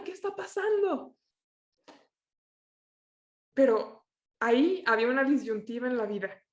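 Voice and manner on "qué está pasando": acted-out high, baffled protest; bewildered outcry. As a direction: wail the question in mock dismay.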